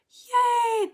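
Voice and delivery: loud, very high drawn-out cheer; delighted cheer. Real, not acted. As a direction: celebrate the student with a high, loud whoop. Delighted by student's progress message.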